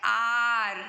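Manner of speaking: आर said loud and drawn out with an open vowel start; pronunciation demo. Shown as a demonstration. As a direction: stretch आर loudly from its opening 'aa'. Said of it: "आवर बोलते हैं या आर भी बोलते हैं". (आर को ज़ोर से, 'आ' ध्वनि से खींचकर)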